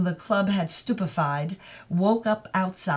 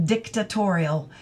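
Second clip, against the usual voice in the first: plain reading voice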